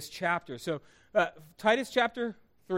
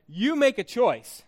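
lightly voiced stern coach, louder and slower; football coach voice; 5 semitones higher, 5 dB louder, slower than usual. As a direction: lay down an ultimatum like a coach. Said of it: "my football coaching face and voice".

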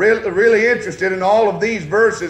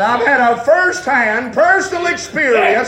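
fiery preaching; 4 semitones higher than usual. Acted. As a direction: preach it full force to the congregation. Preaching at full force.

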